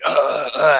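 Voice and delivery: loud mock coughing fit; re-enacted hacking cough; imitated choking cough. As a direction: act out choking on a drink. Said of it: "I'm just fucking coughing like crazy".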